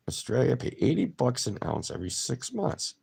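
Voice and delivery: usual speaking voice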